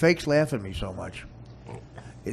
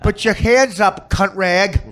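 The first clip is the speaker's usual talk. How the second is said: cop talk; 9 semitones higher, 13 dB louder, slower than usual. Retold or imitated. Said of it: "they talk like cops".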